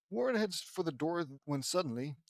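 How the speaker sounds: ordinary delivery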